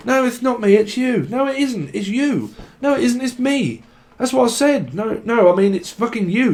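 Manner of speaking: high-pitched mock argument; acted squabbling. Couple arguing over who is at fault, bickering. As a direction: bat the blame back and forth. Acts out a blame-swapping quarrel.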